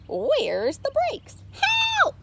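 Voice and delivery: slow alarm, then a very high yelp for help; a panicked cow who can't brake; Molly panicking. A narrator's reading. Molly the cow panicking at the wheel. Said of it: "Molly uttered".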